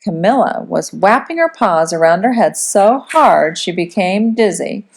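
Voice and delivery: plain reading voice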